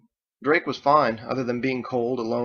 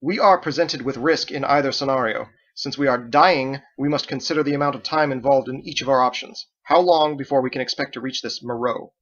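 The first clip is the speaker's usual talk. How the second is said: loud, declaiming like a prosecutor; courtroom argument; 3 semitones higher, 6 dB louder than usual. Acted. Andolin makes her case to the crew, loud and formal like a courtroom. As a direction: argue it like a lawyer in court. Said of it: "announced her argument like she was in a courtroom".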